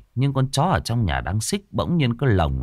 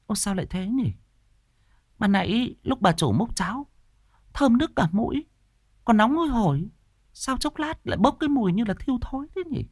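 much higher woman's voice, baffled; puzzled wife; 12 semitones higher than usual. A narrator's reading. Wife sniffs the spoiled porridge, baffled. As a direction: wonder aloud, confused.

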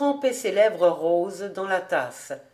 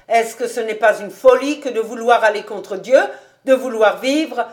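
loud, emphatic rhetorical questions; heated old rabbi haranguing; indignant preaching; 8 dB louder than usual. A narrator's reading. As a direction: press the argument with raised voice. Rabbi argues heatedly from scripture.

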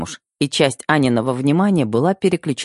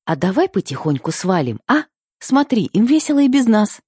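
playful bride; 8 semitones higher than usual. Acted. Bride coaxing groom to sneak away.

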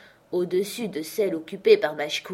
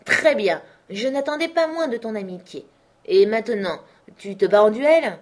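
louder sly question; spring the duel on him; Manxit pouncing; 4 semitones higher, 6 dB louder than usual. A narrator's reading. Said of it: "Manxit confronts uncle". (Manxit qui démasque le neveu, plus fort)

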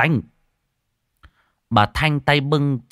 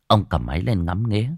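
usual speaking voice

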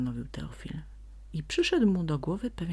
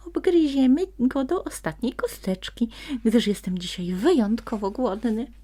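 high, loud pretend threat; mock-menacing sprite; 9 semitones higher, 7 dB louder than usual. A narrator's reading. Sprite pretends to threaten the fox, hammed up. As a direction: play the hungry ogre for the fox. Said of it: "robiąc przy tym groźną minę".

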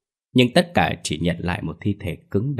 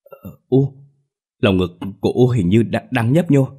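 higher, startled, halting words; 4 semitones higher, 4 dB louder than usual. Acted. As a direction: catch the breath in disbelief. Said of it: "làm ông giật mình". (giọng giật mình, ngập ngừng)

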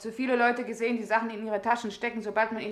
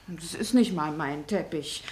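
normal talking voice

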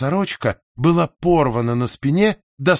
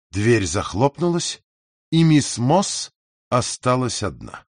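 ordinary delivery, nothing marked

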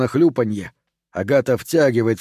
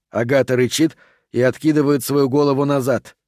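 ordinary delivery, nothing marked

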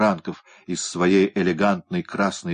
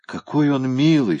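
растроганно; 7 semitones higher, 4 dB louder than usual. A narrator's reading. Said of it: "прослезившись".

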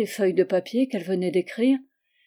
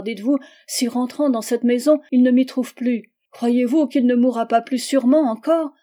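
réplique désespérée; 4 semitones higher, 7 dB louder than usual. Acted. Charlotte réplique avec désespoir.

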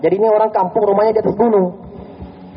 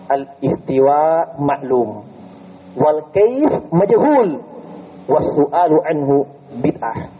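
slow solemn recitation; 4 semitones lower, much slower than usual. Shown as a demonstration. Reciting Imam Malik's Arabic saying.

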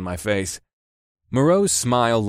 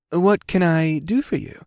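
slow, higher, wary shopkeeper voice; a cautious salesman sizing them up; 8 semitones higher, slower than usual. Acted. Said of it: "with a slightly wary expression".

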